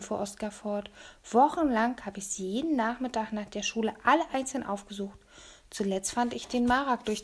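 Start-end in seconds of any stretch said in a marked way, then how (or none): none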